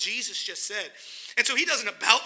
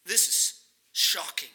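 slowed and lowered, let it sink in; slow, weighty; 4 semitones lower, slower than usual. Acted. Preacher letting the shock sink in, slow and low. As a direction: drop the volume and drag it out. Preacher repeating the point softly.

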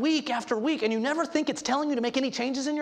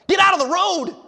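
loud, higher urgent yell; sharp rebuke shout; 7 semitones higher, 11 dB louder than usual. Shown as a demonstration. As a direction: bark it like a truck is coming. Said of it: "this is a sharp admonishment".

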